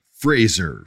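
"Fraser" pronounced FRAY-zer. Shown as a demonstration. Host trying out a questioner's surname, "Fraser" as FRAY-zer. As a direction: say "Fraser" with a long A and a Z. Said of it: "Now we're gonna mess it".

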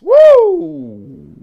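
high, loud whoop of delight; excited woo. Real, not acted. Host cheering his own motto, loud whoop. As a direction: cheer like the point landed.

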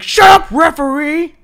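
high, loud, drawn-out mock shout; imitated angry yell. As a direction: bellow at the ref like an enraged wrestler. Acts wrestler yelling at counting referee.

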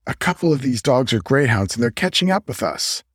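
ordinary delivery

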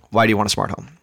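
normal talking voice

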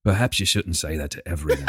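low, soft, polite English reproach; English accent. Acted. English friend warning him after two days, English accent. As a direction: gently caution in a quiet English accent. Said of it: "he's like".

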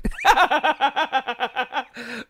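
long chuckling laugh; rolling fit of giggles. Real, not acted. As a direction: crack up at your own pun. Laughing at own joke name.

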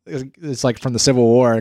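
ordinary talk

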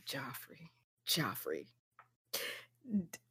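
very soft, sighed-out name; hushed, weary dismay; exasperated sigh. Real, not acted. Sighing over a disliked character.

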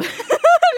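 squealing high laugh; high burst of laughter. Real, not acted. Laughs at shocked host. (ضحكة عالية)

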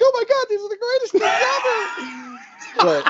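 exaggerated falsetto shriek of delight; mocking squeal. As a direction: imitate a friend gushing, voice shooting up octaves. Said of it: "Why did your voice go up, like, ten octaves?".

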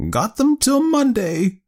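put-on high, slow and thrilled old aunt; delighted, half awed. A narrator's reading. Aunt boasting of the keys to her nephew, delighted. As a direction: announce it high and drawn out, delighted. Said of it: "delighted yet half awesome voice".

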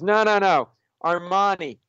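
imitated lawyer cutting in; emphatic correction. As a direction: overrule them like a know-it-all colleague. Lawyer argues over the best shirt.

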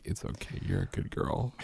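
very soft, half-whispered comfort; barely audible tender reassurance; soothing. Retold or imitated. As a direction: hush a forgiven child, retold. Father soothing apologetic child.

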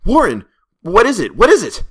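high, loud, panicked demand; terrified. A narrator's reading. Narrator calling down to his friend, terrified shout. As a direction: cry out in terror for an answer. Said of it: "Terrified, I continued to repeat".

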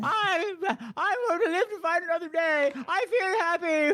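high-pitched, drawn-out mock triumph; hammed-up elation. Host joking as the escaped survivor, hammed-up high elation. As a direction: play a giddy survivor in a high voice.

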